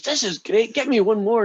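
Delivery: thrilled high call to the barman; excited head. Acted. Head cheers as its arms grow back.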